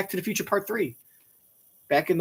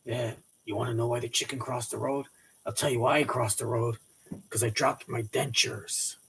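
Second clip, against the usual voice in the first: Clint Eastwood voice; 7 semitones lower, 7 dB quieter than usual. Pretend. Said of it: "anything sounds funny when Clint Eastwood says it".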